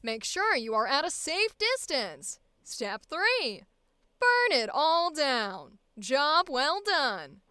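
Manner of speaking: brisk transatlantic newsreel announcer; 1950s corporate safety film narrator; old-timey announcer. Acted. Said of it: "That's a fun voice".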